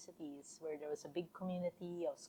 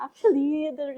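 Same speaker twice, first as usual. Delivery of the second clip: loud burst of real laughter, higher; laughing; 9 semitones higher, 18 dB louder, much slower than usual. Real, not acted. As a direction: laugh out loud, then catch yourself. Laughing at host's "I sure hope not.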